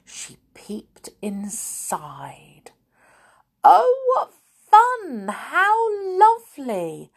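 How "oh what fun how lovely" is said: high, drawn-out delighted exclamation; acted delight. A narrator's reading. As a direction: marvel in a high, loud voice. Connie delighted by what she sees.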